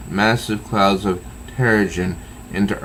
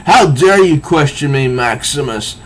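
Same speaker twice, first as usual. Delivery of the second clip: higher, louder haughty outrage; uptight indignation; 6 semitones higher, 5 dB louder than usual. Retold or imitated. Old king scolding Maximus, mock outrage. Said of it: "all uptight".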